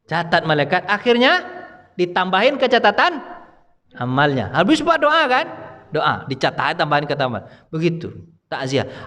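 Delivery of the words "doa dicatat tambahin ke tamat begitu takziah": usual speaking voice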